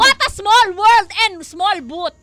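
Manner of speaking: loud, slow exclamation; drawn-out exclamation. Real, not acted. Host marvels at a shared birthday, loud and slow. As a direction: stretch it out and lean into it loudly.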